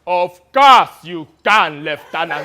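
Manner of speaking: loud, slow, hammed-up African warlord. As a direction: boom it out like a pompous militia general. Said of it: "in his best impression of an African warlord".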